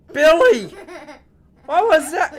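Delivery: high, loud panicky robber; alarmed call. Acted. Robber by the door calling to Billy, alarmed. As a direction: cry out in hammed-up alarm.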